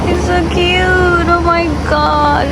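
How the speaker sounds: high, slow doting coo. Real, not acted. Cooing over her baby.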